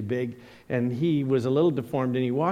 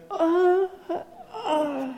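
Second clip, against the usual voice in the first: high, breathy caught stammer with a half laugh; caught red-handed stammer; much slower than usual. Retold or imitated. Boy caught by his shop teacher, high startled stammer. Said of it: "And I went".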